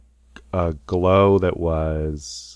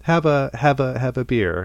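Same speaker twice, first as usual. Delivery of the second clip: a lightly voiced crowd, higher and coaxing; pushy partygoers; 8 semitones higher, 3 dB louder than usual. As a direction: coax him into a drink, pitched up. Partygoers pushing a beer on him.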